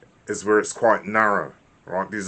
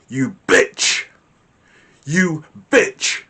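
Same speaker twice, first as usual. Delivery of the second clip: louder, higher, spat-out insult; angry snarl; 5 semitones higher, 5 dB louder, much slower than usual. Shown as a demonstration. Teacher demos an angry insult to the class. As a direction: spit the insult with venom. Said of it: "Can you hear the difference in the tone and the frequency".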